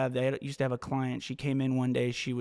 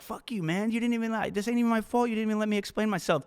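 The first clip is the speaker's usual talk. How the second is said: pitched-up imitation of an angry retort, fast at the end; hammed-up indignant comeback, much higher; mock angry retort; 8 semitones higher, much faster than usual. Client flipping off the rude man, mock angry higher retort. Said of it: "'cause she's angry".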